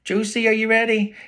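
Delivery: high, loud call up the stairs; shouting from downstairs. A narrator's reading. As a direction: call out to someone on another floor. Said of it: "shouting from the bottom of the stairs".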